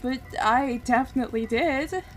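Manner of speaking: loud, slow, pained remembering; anguished dying character. Acted. Character remembering his true name and death, anguished. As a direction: let every word ache with pain.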